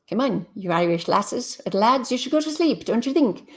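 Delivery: playful, badly faked Irish lilt; bad Irish accent. Streamer teasing late-night Irish viewers to go to sleep, bad Irish accent. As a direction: put on a hammy Irish accent. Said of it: "that's a bad accent".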